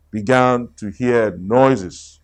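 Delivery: usual speaking voice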